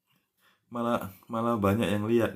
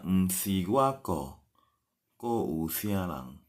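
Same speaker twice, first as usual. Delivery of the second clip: slow model sentence; much slower than usual. Shown as a demonstration. Teacher repeats the Hokkien translation for learners, very slowly.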